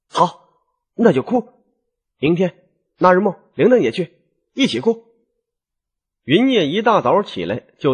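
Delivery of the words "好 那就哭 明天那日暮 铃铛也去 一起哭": high, lightly voiced resolute wife; grudging resolve. A narrator's reading. Wife agrees to go cry by the river, high and resolved. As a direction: make up your mind in short, high bursts. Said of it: "新月咬咬牙说".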